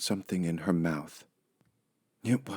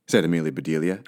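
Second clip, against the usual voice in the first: ordinary delivery, nothing marked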